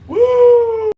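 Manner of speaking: high loud celebratory whoop. Real, not acted. Streamer cheering a donor, high loud whoop. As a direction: let out a big cheering woo.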